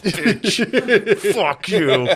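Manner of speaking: high-pitched, laughing insult; mock cursing. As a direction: curse in mock rage, breaking into laughter. Retells what he thought at her.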